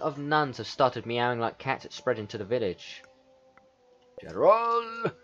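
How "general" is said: high, drawn-out call; summoning call. Acted. King summons the general about meowing nuns, high call. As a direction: sing out for an officer.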